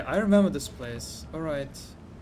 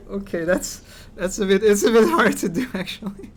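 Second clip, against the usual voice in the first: ordinary talk, nothing marked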